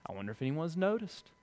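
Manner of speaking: imitating a proud believer's hushed self-congratulation; smug inner thought. Preacher voicing a proud believer.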